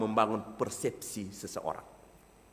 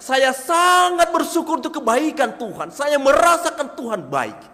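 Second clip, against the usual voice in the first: much higher and louder, full of joy; strongest level; 14 semitones higher, 11 dB louder than usual. Shown as a demonstration. Preacher showing the strongest level of thanks, high, loud and joyful. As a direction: pour out thanks with full conviction. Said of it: "mana pesan lebih kuat?".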